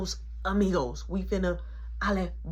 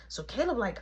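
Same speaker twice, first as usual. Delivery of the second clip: ordinary delivery, nothing marked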